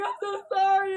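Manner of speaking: high, loud wailing regret; exaggerated sobbing plea; mock tearful apology. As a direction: cry out the apology, hammed-up. Retold tearful apology to father.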